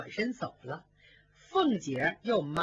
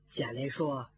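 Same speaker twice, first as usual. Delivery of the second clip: ordinary delivery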